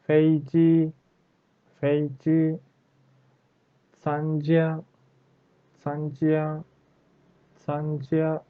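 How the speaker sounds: "Fei Ji", "Sanjia" high and level on each syllable; double first tone. Shown as a demonstration. Teacher drills first-tone words, high and constant. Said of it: "Our sound is constant".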